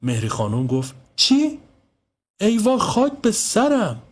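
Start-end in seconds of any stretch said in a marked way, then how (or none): 1.2-4.0 very high shocked cry, then drawn-out lament; panicked mother lamenting; horrified wail. Acted. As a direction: shriek "what?", then wail in dismay. Mother hears her son is missing.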